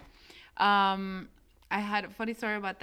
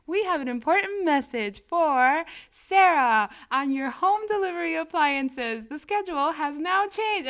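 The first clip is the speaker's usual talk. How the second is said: mock automated message; 7 semitones higher, 5 dB louder than usual. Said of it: "the voice messages were like".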